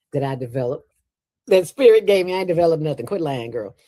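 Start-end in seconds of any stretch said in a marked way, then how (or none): 1.5-2.3 emphatic correction. Real, not acted. Self-correcting with mock emphasis.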